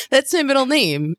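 laughing through the words. Real, not acted. Co-host laughing at the host's nickname for her, high and giggly.

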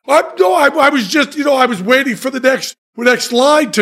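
high, fast, flustered stammer; flustered excuse. Retold or imitated. Caught daydreaming by the actress, flustered high stammer. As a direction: scramble for an excuse, voice jumping up.

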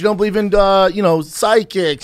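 loud heated insistence. Real, not acted. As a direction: push back loudly. Heated argument about Bruce Lee.

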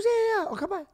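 high-pitched rapid agreement; eager friend. Retold or imitated. Friend accepting house invite, high eager imitation.

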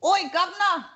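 a loud, high put-on British accent, imitating dad; Cockney greeting, hammed up loud and high; mock London accent shouting oi governor. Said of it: "This is what you do".

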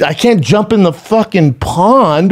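exasperated protest. Retold or imitated. Dad answering son's plea to rescue turtle, exasperated. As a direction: stretch it out as a retold objection. Father retells helpless protest.

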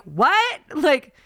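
high-pitched, incredulous complaint; exasperated demand. Retold or imitated. Traveler retells straining to hear mumbling agent, exasperated.

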